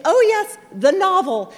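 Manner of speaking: high, loud, theatrical sigh of a mother; affected mom. Retold or imitated. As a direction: drape the words with mock-weary drama. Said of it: "in this very affected fashion".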